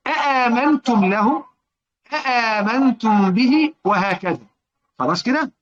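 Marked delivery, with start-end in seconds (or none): 0.1-3.7 slow, raised "أآمنتم" with both hamzas fully sounded; full second hamza. Shown as a demonstration. Teacher reciting for students how they read, both hamzas clear. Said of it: "قرأوا بتحقيق الهمزة الثانية".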